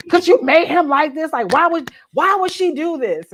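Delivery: loud, high reader's rebuke; mock mad. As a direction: imitate an exasperated reader. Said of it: "I'm mad at you today".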